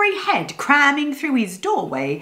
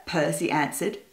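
ordinary delivery

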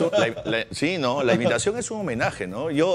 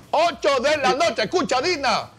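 1 hyped announcement; 9 semitones higher, 3 dB louder than usual. Acted. Announcing his show to Dina.

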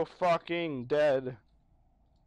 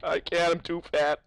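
usual speaking voice

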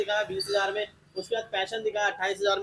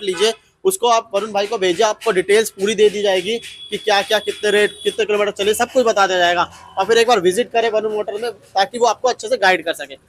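loud, upbeat showroom promo; promo outro; 8 dB louder than usual. Acted. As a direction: push the showroom to viewers. Loud closing call to action.

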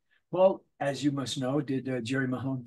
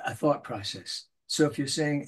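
normal talking voice

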